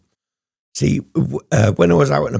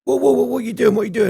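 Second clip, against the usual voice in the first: high, alarmed imitation of the barmaid; shocked barmaid; 13 semitones higher, much faster than usual. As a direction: squeak it like the startled barmaid catching him. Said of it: "and say".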